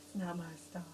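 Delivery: deadpan it like a bored bad actress; hushed, low, lifeless imitation; Lindsay Lohan impression. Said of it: "She goes".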